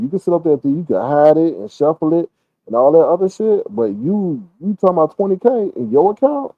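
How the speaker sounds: ordinary talk, nothing marked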